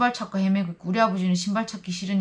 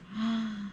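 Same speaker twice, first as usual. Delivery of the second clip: short emotional gasp; soft gasp of recognition; 3 semitones higher, 8 dB quieter than usual. Retold or imitated. Sister seeing her brother after years, soft gasp. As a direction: gasp as you see him.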